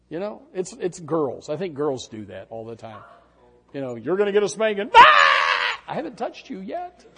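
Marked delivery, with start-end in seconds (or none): 4.0-4.9 louder, higher threatening parent; parent's threat shout. Retold or imitated. 4.9-5.8 high, loud imitated scream; imitating a girl screaming before a spanking; mock child shriek.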